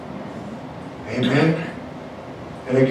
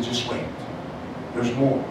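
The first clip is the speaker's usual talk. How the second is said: low, hushed voice of God; quiet, low divine reassurance; 5 semitones lower, 10 dB quieter than usual. Retold or imitated. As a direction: speak softly into the heart, as God would. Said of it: "he spoke to my heart".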